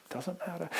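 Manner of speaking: soft, reassuring answer; quiet dismissal. Acted. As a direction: let it fall away softly. Pastor's answer to each objection.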